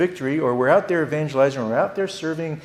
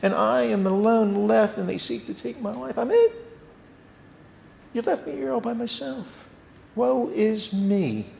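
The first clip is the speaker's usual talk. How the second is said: high, whiny complaint; whining self-pity; 8 semitones higher than usual. Retold or imitated. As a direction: moan like a sulking prophet. Elijah's self-pitying complaint voiced.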